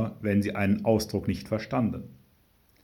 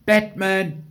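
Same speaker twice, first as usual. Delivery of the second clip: high, sudden shout; yelled; 11 semitones higher, 5 dB louder than usual. A narrator's reading. Girl cuts into mother's Bible reading, high yell. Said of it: "schrie Hedwig".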